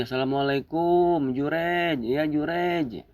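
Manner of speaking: high, drawn-out calls; mother calling out. Acted. Mother calling her unanswering son a third time, calling out. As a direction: call the name high and stretch it.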